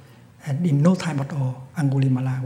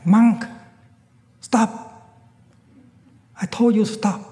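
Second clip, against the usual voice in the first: loud, high angry yell; second, angrier shout; 8 semitones higher, 8 dB louder than usual. Acted. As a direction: yell it, furious he's ignored. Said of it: "Angulimala called for a second time".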